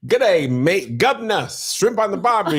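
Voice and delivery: jokey Aussie stock phrases; Australian accent. Shown as a demonstration. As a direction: badly fake an Australian accent.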